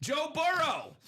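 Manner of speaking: excited hype. Acted. Hyping the hip young quarterback.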